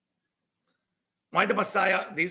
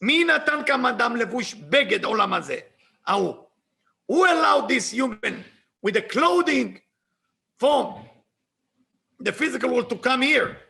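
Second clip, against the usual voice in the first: raised, indignant challenge; upset Messiah; 2 semitones higher, 5 dB louder than usual. Retold or imitated. Messiah demanding who let this human in, upset. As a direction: demand it loudly, acting out the outrage. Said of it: "watching the Mashiach getting upset".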